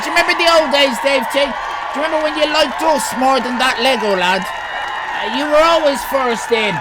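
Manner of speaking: playful loud guilt trip; loud teasing. Real, not acted. Teasing Dave T in chat.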